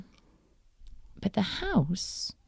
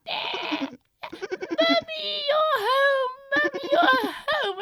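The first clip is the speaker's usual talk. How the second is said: put-on excited little kids; 3 dB louder than usual. Excited kids greeting mummy.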